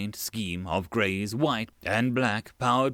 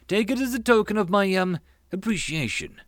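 insistent suitor; 8 semitones higher than usual. Acted. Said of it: "De John insisted strongly".

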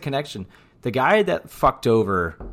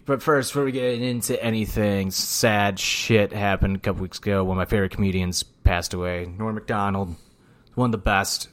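usual speaking voice

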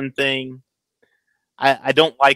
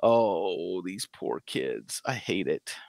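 soft pitying; 5 semitones lower, 10 dB quieter, much slower than usual. Retold or imitated. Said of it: "I'm just like".

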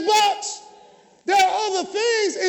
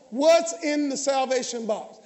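ordinary talk

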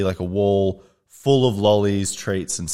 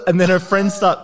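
ordinary talk, nothing marked

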